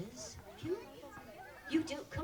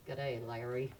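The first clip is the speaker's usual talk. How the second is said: low, lightly put-on Australian greeting; Australian accent; 6 semitones lower than usual. Shown as a demonstration. Presenter leads kids greeting a lizard, light low Australian accent. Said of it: "my very best Australian accent".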